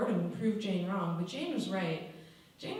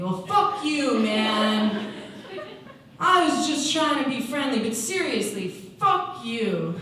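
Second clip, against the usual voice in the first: loud, offended telling-off; offended retort; 4 semitones higher, 7 dB louder, slower than usual. Acted. Girl rebuffing the newcomer who insulted her, angry. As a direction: snap back loudly, hurt and angry. Acted quarrel after the insult.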